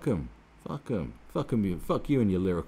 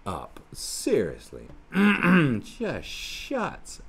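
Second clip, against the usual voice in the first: irritated outburst; 5 semitones higher, much slower than usual. Real, not acted. Snapping at the writer.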